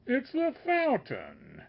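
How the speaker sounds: high, slow, earnest sage; the sage answers the master with conviction, high and slow. A narrator's reading. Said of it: "he replies with conviction".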